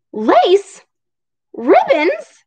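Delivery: high, loud astonished questions; shocked story character; startled disbelief. Acted. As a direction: exclaim each word in shocked surprise. Startled discovery in read story.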